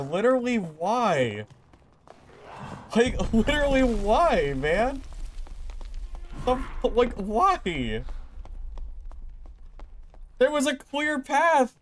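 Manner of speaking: high-pitched why and there was a clear path; exasperated ranting. Real, not acted. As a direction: rant at the unfair block. Raging at the game outcome.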